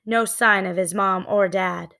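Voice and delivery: usual speaking voice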